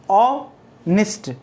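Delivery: "ओ", pause, "निस्ट"; teacher breaking the word after "ओ"; split into syllables. Shown as a demonstration. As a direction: say "ओ" and "निस्ट" as two separate parts. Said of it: "ओ अलग बोलेगा, निस्ट अलग बोलेगा".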